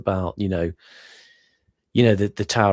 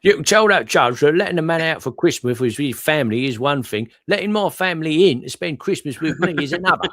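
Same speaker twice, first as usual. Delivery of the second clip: higher, louder, put-on indignant old lag; grumpy prisoner; 8 semitones higher, 5 dB louder than usual. Reading the cartoon prisoner's complaint to the guard, indignant put-on voice. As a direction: grumble it like a prisoner who wants his cell to himself.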